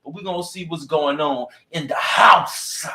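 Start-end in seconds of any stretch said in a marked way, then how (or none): 1.7-2.9 show-host catchphrase, HOUSE held long; drawn-out catchphrase. Shown as a demonstration. Host cues listeners before funny church clips, drawn-out catchphrase. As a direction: stretch HOUSE out long with a swoop in pitch. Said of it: "You gotta say it with me".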